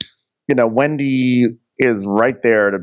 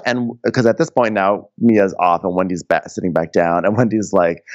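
ordinary talk

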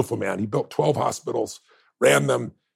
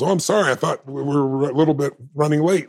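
bursting in with a hurried, bright apology, pitched up and louder; breathless apology; 3 semitones higher, 4 dB louder than usual. Retold or imitated. Governor bursts in late, apologizing to the crowd, flustered and raised. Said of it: "she goes bursting in and goes".

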